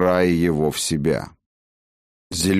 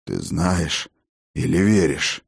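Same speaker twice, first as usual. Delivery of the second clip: heavy exhale. A narrator's reading. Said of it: "Тяжело выдохнул отец дружин".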